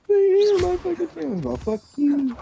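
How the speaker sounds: very high-pitched mocking squeal; mock offended viewer. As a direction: squeak out a hammed-up hurt complaint. Mocks an offended viewer complaining.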